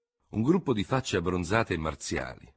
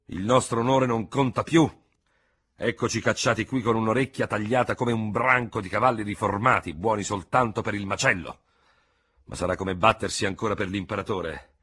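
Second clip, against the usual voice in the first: bitter outburst; 4 semitones higher, 6 dB louder than usual. Acted. General's bitter tirade.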